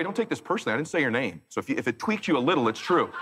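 usual speaking voice

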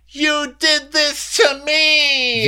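high, slow, drawn-out wailing screech; mock scream. Castmate blames her rival, imitated drawn-out scream. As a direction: scream it like a hammed-up victim. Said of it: "who just screamed".

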